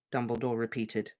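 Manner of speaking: usual speaking voice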